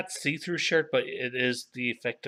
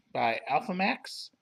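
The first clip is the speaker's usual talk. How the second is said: ordinary delivery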